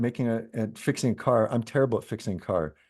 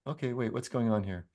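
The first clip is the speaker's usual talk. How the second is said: ordinary talk, nothing marked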